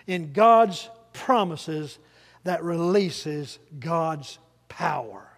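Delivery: each phrase slow, split by pauses; slow, deliberate. Real, not acted. Preacher drives the key line home to congregation, slow and deliberate. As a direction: spell it out slowly, word by word.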